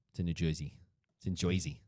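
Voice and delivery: put-on playful Joisey accent; New Jersey accent. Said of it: "offend all of our Jersey listeners".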